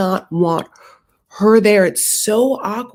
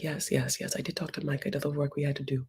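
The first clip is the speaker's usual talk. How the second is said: much softer, lower mock reply; imitating a quiet lowered answer to Natalie; quiet hurried reassurance; 5 semitones lower, 13 dB quieter, faster than usual. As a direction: murmur it low and dismissive. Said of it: "She's like".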